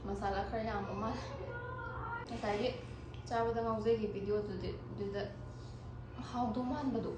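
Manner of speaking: talking with a mouthful of food; quiet, chewing between words; chewing while talking. Real, not acted. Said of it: "ꯉꯁꯥꯏꯒ ꯆꯥꯕ ꯍꯧꯔꯒ ꯋꯥꯒ ꯉꯥꯡꯉꯒ".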